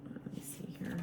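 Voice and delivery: very soft, voiceless whisper to yourself; whispered aside. Real, not acted. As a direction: whisper it under your breath, as a private aside. Muttering to herself while checking cards.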